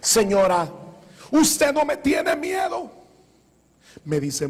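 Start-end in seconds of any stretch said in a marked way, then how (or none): 0.0-2.9 challenging shout. Retold or imitated. Boy challenges the old woman.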